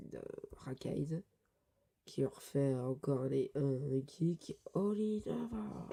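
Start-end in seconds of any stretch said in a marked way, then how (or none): 4.7-5.9 high, drawn-out attack call; attack cry. Acted. Reading Sting's attack aloud, high attack cry. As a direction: sing out the move's name high. Manga attack name called out.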